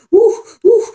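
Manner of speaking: high, loud mock woo of excitement; mock hyperventilating. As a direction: squeal like a panicking lady.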